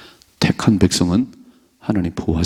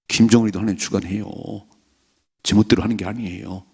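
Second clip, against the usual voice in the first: ordinary talk, nothing marked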